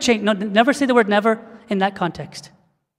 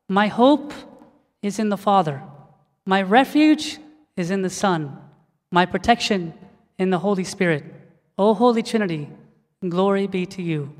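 reverent prayer recitation; 2 semitones lower, 4 dB quieter than usual. Acted. Preacher leading church in a short prayer, soft reverent recitation.

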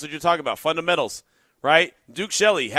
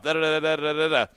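rapid-fire monotone string of syllables; rattled off; faster than usual. Retold or imitated. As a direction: imitate someone reeling off a play call. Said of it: "and then he rattled it off".

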